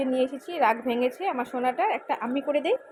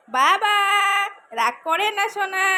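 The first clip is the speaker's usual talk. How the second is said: coaxing son; 9 semitones higher, 9 dB louder, slower than usual. Real, not acted. Mother coaxing sulking son.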